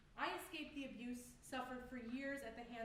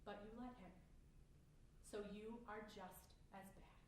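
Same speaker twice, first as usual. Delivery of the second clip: softer, low-key accusation after a pause; quiet condemnation; 3 semitones lower, 10 dB quieter than usual. Acted. Blaming mother for letting it happen, quietly accusing. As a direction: drop the volume and lay the blame.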